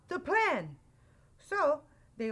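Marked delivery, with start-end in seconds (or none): 0.1-0.8 high-pitched urgent call; crying out. A narrator's reading. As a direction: call out a reminder in alarm. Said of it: "cried Al".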